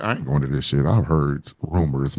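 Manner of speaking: a low, reluctant mutter, head down; quiet mumble. Retold or imitated. Fabulous dodging Puffy, low and mumbled. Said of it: "He's like".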